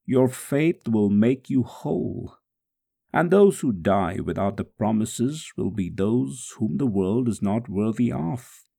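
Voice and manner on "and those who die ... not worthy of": ordinary delivery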